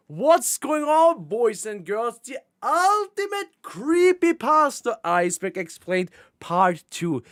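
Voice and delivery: drawn-out high hype announce; hype intro. Acted. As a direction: belt out the title slowly. Hyped episode intro.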